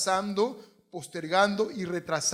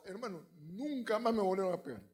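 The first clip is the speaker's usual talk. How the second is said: normal talking voice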